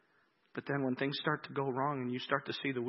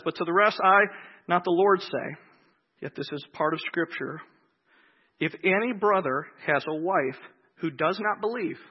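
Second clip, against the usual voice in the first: loud, higher preaching read of the verse; scripture reading; 4 semitones higher, 10 dB louder than usual. Acted. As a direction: proclaim the passage from the pulpit. Reads Scripture aloud.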